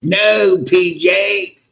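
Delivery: yelping like the guy in the clip; mock scream. Streamer mimicking Dolly pleading with PJ, high mock scream.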